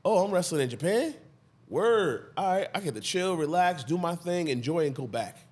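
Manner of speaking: laid-back, easygoing voice; no worries, just chilling; carefree chill. Retold or imitated. Imagined carefree self.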